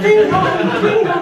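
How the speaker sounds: high sung ding dong like a doorbell; doorbell imitation. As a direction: sing the chime of a doorbell.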